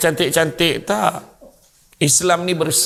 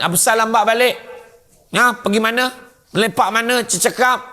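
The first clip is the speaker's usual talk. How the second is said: higher-pitched nagging questions; wife scolding; 6 semitones higher, faster than usual. Acted. Wife questioning husband who came home late, scolding. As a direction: interrogate him like a cross wife. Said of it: "Balik lambat kena marah".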